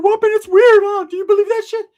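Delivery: very high, loud squeaky cartoon voice; hammed-up falsetto of an excited cousin; Ricky. Imitating cousin after a scary night, high falsetto.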